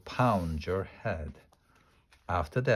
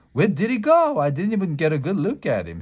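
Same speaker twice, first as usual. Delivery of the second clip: high, fast and louder; eager; 7 semitones higher, 5 dB louder, faster than usual. A narrator's reading. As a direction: crane to see him. Grace eager to see boy.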